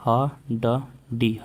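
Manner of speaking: ह ड डी spelled out very slowly, letter by letter; teacher spelling हड्डी letter by letter. Shown as a demonstration. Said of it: "हड्डी लिखेंगे".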